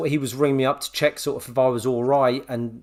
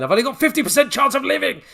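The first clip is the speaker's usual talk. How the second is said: high, fast, hammed-up panic; quoting a panicking sitcom dad, mock dread; 12 semitones higher, 4 dB louder, faster than usual. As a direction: blurt it like a man sure he's dying. [name] retelling his panicked countdown.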